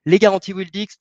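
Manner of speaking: emphatic announcement; insisting. Real, not acted. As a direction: lean hard into the headline. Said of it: "donc là j'insiste".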